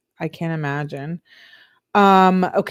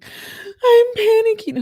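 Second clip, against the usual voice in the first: very high, drawn-out squeal; tiny high-pitched wail of pretend panic; mock panic; 6 dB louder, slower than usual. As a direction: squeal it out slowly in hammed-up panic.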